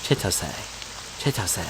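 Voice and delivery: soft Chitose, chee-TOH-seh, falling; practising Chitose. Shown as a demonstration. Gamer drilling the name aloud, quiet Chitose practice. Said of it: "I'll say it, like, five times now".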